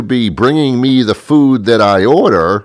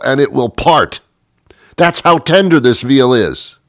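raving excitement; 6 semitones higher than usual. Real, not acted. Gushing about tender veal.

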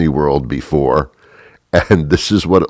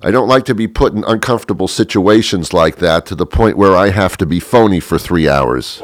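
ordinary talk, nothing marked

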